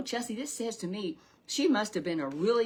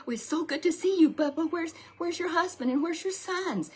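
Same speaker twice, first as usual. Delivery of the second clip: much higher, louder gushing welcome; excited greeting; 10 semitones higher, 5 dB louder than usual. Retold or imitated. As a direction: squeal with delight at an old friend. Townspeople greeting again.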